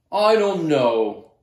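slow, loud, drawn-out moan; a hammed-up bored moan. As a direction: groan it out, bored to bits. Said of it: "moaned Kansas".

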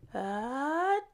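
a high, sudden stagey sneeze; big sneeze. A narrator's reading. Narrator voicing the elephant's sneeze, high and sudden.

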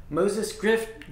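ordinary delivery, nothing marked

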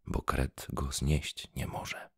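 ordinary delivery, nothing marked

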